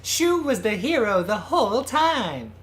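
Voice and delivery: slow, high, sing-song mock announcement; mock revelation. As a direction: ham up a big twist reveal. Hammed-up mock plot twist.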